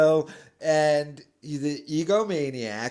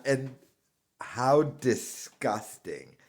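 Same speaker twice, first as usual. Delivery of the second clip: ordinary delivery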